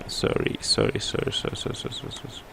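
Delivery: repeated sorry under the breath, fading away; muttered apology. Real, not acted. Teacher apologizing mid-demonstration for a slip, muttered and fading. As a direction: whisper a string of sorries, trailing off.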